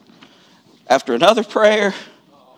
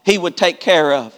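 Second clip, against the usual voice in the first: normal talking voice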